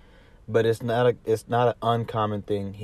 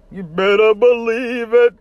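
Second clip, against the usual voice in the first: high, loud put-on rapper voice; 13 semitones higher, 8 dB louder than usual. [name] catchphrase imitated.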